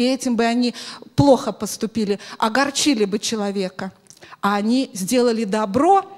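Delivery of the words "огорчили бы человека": ordinary delivery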